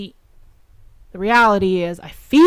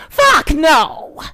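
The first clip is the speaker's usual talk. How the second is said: furious yell, high and loud; shouting in disgust; angry shout; 8 semitones higher, 6 dB louder than usual. Real, not acted. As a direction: blast it out in outrage. Angry rant outburst.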